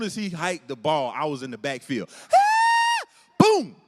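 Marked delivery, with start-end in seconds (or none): 2.1-3.0 loud, piercingly high scream; comic shriek as you charge in; mock rage squeal. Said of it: "I've never been this angry in my life". 3.4-3.8 loud, high-pitched boom; explosive impact shout. Acted. Acting out the tackle.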